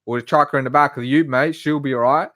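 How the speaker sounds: loud, matey Aussie accent; Australian accent. Shown as a demonstration. Streamer showing off his Australian impression after living there, Australian accent. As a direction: lay on a broad, hearty Australian accent. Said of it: "I managed to do the Australian one quite well".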